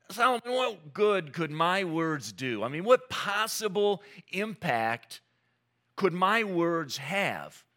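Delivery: earnest questioning. Retold or imitated. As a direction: ask it like a doubter pleading with a wise king, drawn out. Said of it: "and said to him".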